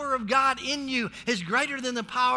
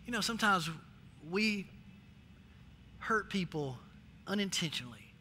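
low, much softer admission; soft confession; 8 semitones lower, 10 dB quieter than usual. Real, not acted. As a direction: lower the voice, confessing.

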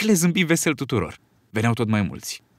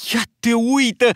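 high, loud surprised exclamation; excited cry of surprise; exclaiming; 11 semitones higher, 4 dB louder than usual. A narrator's reading. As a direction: call out in delight at what he sees. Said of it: "exclamă Piggy".

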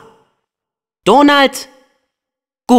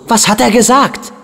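ordinary talk

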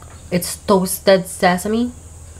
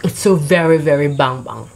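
speaking between bites; chewing while talking; 4 semitones lower than usual. Real, not acted. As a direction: mouth partly full of food. Seen 1: Bitten buchi in hand; closed, working mouth early, then clear speech.

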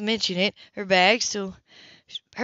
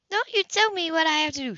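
higher, defiant woman; 9 semitones higher than usual. Acted. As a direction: push back, higher pitched. Grace defiant.

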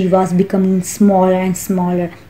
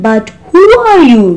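loud, high-pitched demand on the question; indignant storybook caterpillar, voice jumping up; angry challenge; 10 semitones higher, 7 dB louder than usual. A narrator's reading. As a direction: challenge the stranger crossly. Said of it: "said the caterpillar angrily".

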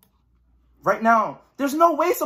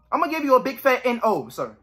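normal talking voice

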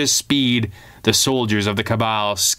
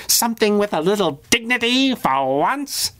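pouting villain; 10 semitones higher than usual. A narrator's reading. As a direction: whine like a spoiled little menace denied his big scene. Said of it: "Kid Chaos pouted".

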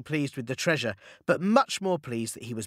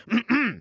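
a loud, high throat clearing; 8 semitones higher, 4 dB louder than usual. A narrator's reading. Momotaro facing the ogres, clears throat loudly. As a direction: clear your throat to get attention. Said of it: "cleared his throat".

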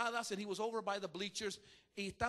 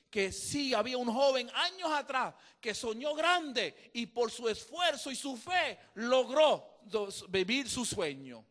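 full-force preaching; 2 semitones higher, 7 dB louder than usual. Acted. Preacher drives home the point to the congregation, full-force preaching.

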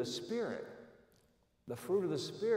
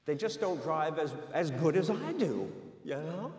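ordinary delivery

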